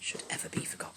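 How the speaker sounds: soft unvoiced cue, barely audible; hushed prompting so only she hears; whispered prompt. Real, not acted. Mum whispering poem prompts to child.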